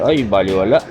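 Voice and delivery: usual speaking voice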